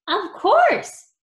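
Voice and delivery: high, loud teasing chirp; playful, piped-up taunt. A narrator's reading. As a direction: tease with a high-pitched swoop. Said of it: "teased Bitewing".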